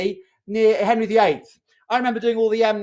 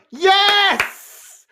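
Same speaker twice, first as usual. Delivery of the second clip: excited yes; 9 semitones higher, 4 dB louder than usual. Real, not acted. Player guesses the charade.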